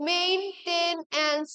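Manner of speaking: মেইনটেন্যান্স slowly as main, ten, ance; measured, separated syllables in মেইনটেন্যান্স; split into syllables. Shown as a demonstration. Teacher shows students the correct spelling, মেইনটেন্যান্স slowly split into syllables. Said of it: "syllable ভাগ করে বলতে পারি".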